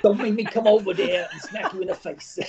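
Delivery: amused, put-on Boston tough; Boston accent. Shown as a demonstration. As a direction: playfully threaten in a Boston accent. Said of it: "can you do a Boston accent".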